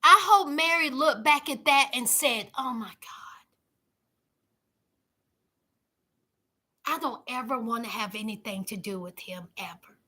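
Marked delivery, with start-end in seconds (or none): 2.6-10.0 a breathless whispered realisation; shocked whisper. Pretend. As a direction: whisper it like Mary just saw the truth. Imagining Mary's shocked reaction.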